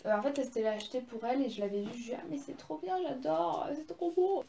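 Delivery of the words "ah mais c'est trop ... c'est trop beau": high, fast gush of delight; higher-pitched, quick rush of mock swooning; imitated gushing admiration. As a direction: re-enact your own swooning over it. Retells her gushing reaction to sister.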